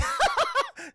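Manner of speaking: loud, very high cackle; hammed-up clown laugh; Joker. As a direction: burst out in mocking villain laughter. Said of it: "And Joker's like".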